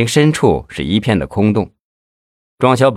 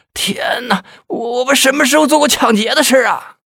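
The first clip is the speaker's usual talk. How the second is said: much higher, desperate wailing plea; crying injustice. A narrator's reading. Beaten suspect wails desperately that he is innocent. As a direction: wail in desperate protest of innocence. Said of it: "石兴良大叫冤枉啊".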